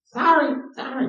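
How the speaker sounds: put-on high, crying little-kid sorry; whiny toddler sorry. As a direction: whimper it like a sobbing toddler. Toddler son forced to apologize.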